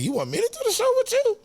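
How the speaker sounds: can't believe the offer, voice jumps way up; incredulous question. Retold or imitated. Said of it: "That's how I said, just like that".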